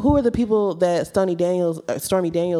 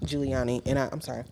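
talking while chewing gum; words round a piece of gum; 3 semitones lower, 7 dB quieter, faster than usual. Real, not acted. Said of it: "I'm chewing gum".

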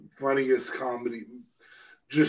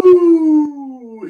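excited whoop; 12 semitones higher, 11 dB louder than usual. Real, not acted. Cheering the next topic.

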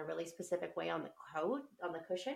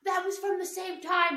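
put-on higher, louder boy's excuse; kid's excuse; 11 semitones higher, 8 dB louder than usual. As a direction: play the son excusing himself. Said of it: "he's like".

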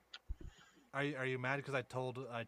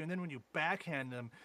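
usual speaking voice

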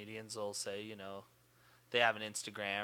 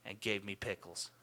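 usual speaking voice

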